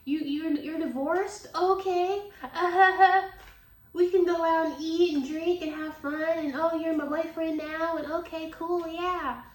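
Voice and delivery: put-on high, airy voice of a naive girl; mocking gullible girl. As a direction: play a starry-eyed girl falling for it. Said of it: "who are like".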